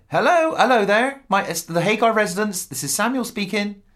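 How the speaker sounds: mock [name]. [name] answering a phone call from [name], lightly prim. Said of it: "Are you gonna be [name]?".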